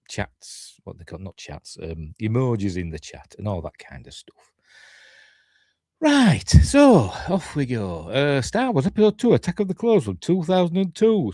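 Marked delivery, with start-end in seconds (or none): 6.0-11.3 holding back a yawn; talking through a stifled yawn; suppressing a yawn. Real, not acted. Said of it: "I'm suppressing a yawn".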